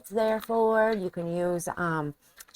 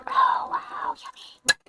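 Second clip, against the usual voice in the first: whispering; slower than usual. Real, not acted. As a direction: whisper it, amazed. Tasting the dish, whispering.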